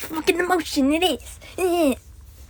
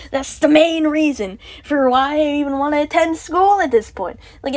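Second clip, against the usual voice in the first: ordinary talk, nothing marked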